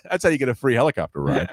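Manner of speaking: normal talking voice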